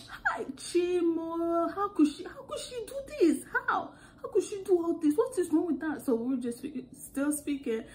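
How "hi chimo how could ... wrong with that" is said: high-pitched, hammed-up wailing; mimicked sister's outcry. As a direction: imitate a sister exclaiming way up high. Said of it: "I'm still speaking like that".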